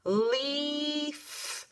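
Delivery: leaf drawn out long to hear the end; stretched modeling word. Shown as a demonstration. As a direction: stretch leaf slowly for the kids. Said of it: "Something's at the end of that word".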